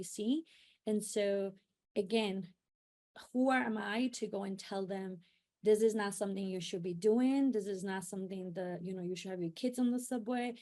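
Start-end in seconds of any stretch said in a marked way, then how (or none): none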